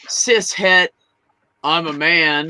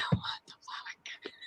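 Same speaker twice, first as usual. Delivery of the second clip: laughing whisper; 18 dB quieter, slower than usual. Real, not acted. Laughing in disbelief.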